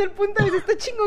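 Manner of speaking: laughing. Real, not acted. Laughing while restarting point.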